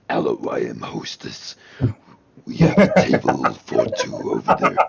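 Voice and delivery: very low void demon voice. Acted. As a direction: growl it like a demon. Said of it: "I'm doing the demon voice".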